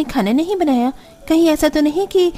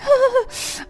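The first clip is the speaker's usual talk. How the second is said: high laugh; 10 semitones higher, 3 dB quieter than usual. Acted. Sister-in-law enjoys the chowmein.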